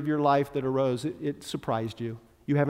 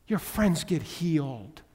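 ordinary delivery

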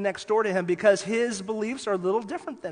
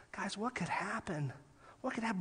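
whispered, much softer, drawing them in; hushed whisper to the congregation; 12 dB quieter than usual. Real, not acted. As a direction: lean in and whisper the question. Preacher whispers rhetorical question.

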